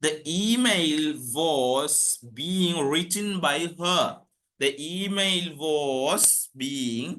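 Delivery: very slow, broken into short chunks; feed it out bit by bit for copying; careful classroom dictation. Shown as a demonstration. Teacher dictates passive sentence.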